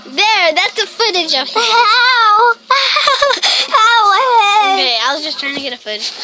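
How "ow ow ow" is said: high, loud "ow" yelps; pained yelps. Real, not acted. Caught in a scuffle, pained yelps. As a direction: cry out ow, half laughing.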